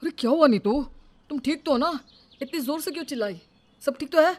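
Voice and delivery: high, loud worried questions; husband hurrying in alarmed; alarmed concern. Acted. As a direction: rush in checking she's fine. Husband runs in after scream.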